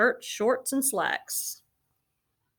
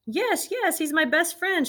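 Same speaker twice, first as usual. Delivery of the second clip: much higher excited cry; girl finding her lost bear, overjoyed; joyful shout; 10 semitones higher, slower than usual. A narrator's reading. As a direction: burst out with relief. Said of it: "shouted Lisa as she came running".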